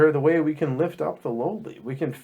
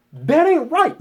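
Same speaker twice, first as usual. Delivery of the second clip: indignant; 12 semitones higher, 5 dB louder, slower than usual. Real, not acted. Emphatic preacher indignation.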